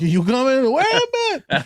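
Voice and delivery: high, urgent call after the truck. Retold or imitated. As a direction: shout after a truck pulling away, voice climbing. Calling after departing truck.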